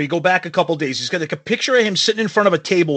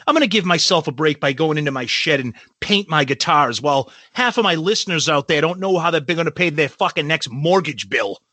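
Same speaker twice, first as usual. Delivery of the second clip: usual speaking voice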